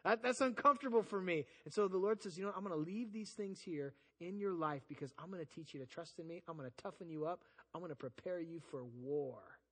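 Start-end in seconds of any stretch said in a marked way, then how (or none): 2.4-9.6 tender God voice. Acted.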